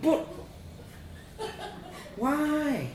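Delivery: high, small, plaintive voice; plaintive child. A narrator's reading. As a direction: whine it like a bewildered child.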